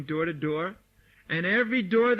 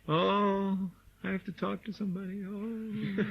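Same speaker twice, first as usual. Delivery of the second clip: softer, shrinking mock fear of talking to someone; mock timid devotee; 6 dB quieter than usual. Teacher mocking his timid students, softer nervous whimper. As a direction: mimic a nervous devotee, much softer and small. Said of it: "But you're all so afraid".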